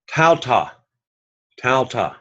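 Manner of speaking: "Tauta" with "au" as the ow in kraut; kraut sound. Shown as a demonstration. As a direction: model "Tauta" clearly, TOW-tah rhyming with "now". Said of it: "ow makes the kraut sound".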